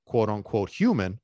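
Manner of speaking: ordinary talk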